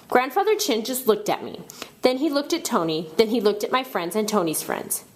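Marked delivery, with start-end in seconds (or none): none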